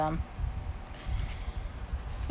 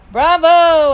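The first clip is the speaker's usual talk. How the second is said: high, loud "bravo" held out; yelled cheer; 9 semitones higher, 13 dB louder than usual. A narrator's reading. Dad cheering at curtain call, yelling. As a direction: yell it out to the stage. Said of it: "I heard Dad yelling through his hands".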